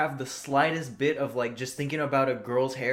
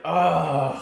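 loud, higher mock groan of exasperation; exasperated groan; 4 semitones higher, 5 dB louder than usual. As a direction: groan as if acting out exhausted patience. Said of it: "like, exas".

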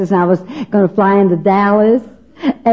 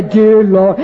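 formal prayer tone; 2 semitones lower than usual. Retold or imitated. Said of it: "Talk to him in another tone of voice".

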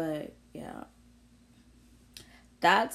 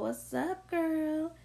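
friendly greeting; 10 semitones higher, slower than usual. Real, not acted. Shouting out to her best friend, a lightly higher greeting. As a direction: call out to her like you just spotted her.

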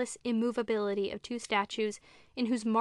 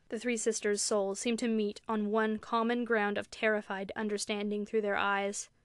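plain reading voice

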